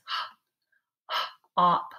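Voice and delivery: breathy "h-, h-" then "op"; "h-, h-, op" isolating the h sound. Shown as a demonstration. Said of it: "change my T to an H".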